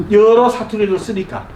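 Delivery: usual speaking voice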